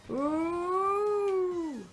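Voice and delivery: ordinary delivery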